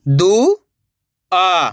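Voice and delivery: each syllable sounded separately, pauses between; letter by letter. Shown as a demonstration. Teacher sounds out a word for beginners, letter by letter. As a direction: break the word into its letters, slowly. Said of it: "एक ही अक्षर का उच्चारण".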